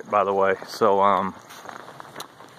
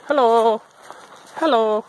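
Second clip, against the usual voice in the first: much higher, louder sing-song greeting; chirpy polite hello pitched way up; bright upward greeting voice, much higher; Japanese hello voice; 13 semitones higher, 6 dB louder, slower than usual. Real, not acted. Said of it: "is that my Japanese hello voice".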